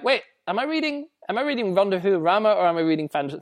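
high-pitched confused double-take; retold confusion, starting high; mock bewilderment. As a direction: act out a baffled reader, pitch jumping up. Retold reader's confusion at aliens.

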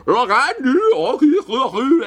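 loud, high, drawn-out comedy Scottish; over-the-top parody Scottish, stretched and shouted; bad Scottish accent. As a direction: ham up a terrible Scottish accent. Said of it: "you do a Scottish accent so badly".

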